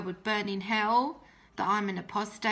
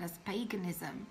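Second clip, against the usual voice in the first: whisper; 3 semitones lower, 8 dB quieter than usual. Retold or imitated. Accusing dad of worshipping idol.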